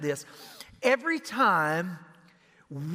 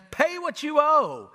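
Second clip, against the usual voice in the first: raised, slow, menacing demand; harsh demand; 10 semitones higher, 8 dB louder, slower than usual. Acted. Servant choking his fellow servant, angry slow demand. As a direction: squeeze the debt out of him.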